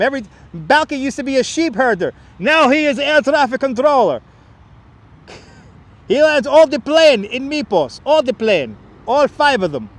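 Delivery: put-on comic Mypos accent bragging about planes; Balky impression. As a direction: play the cheerful foreign sheep herder.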